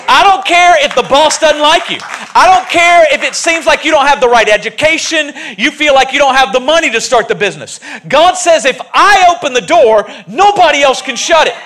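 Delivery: loud, rising preacher build; full-force preaching. Real, not acted. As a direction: preach it hard to the crowd.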